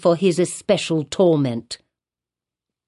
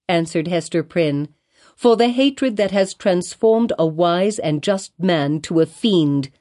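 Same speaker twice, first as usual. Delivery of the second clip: ordinary delivery, nothing marked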